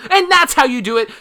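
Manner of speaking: triumphant tutorial finish, loud and high; excited. Pretend. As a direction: land it like a big reveal. Tutorial payoff shout.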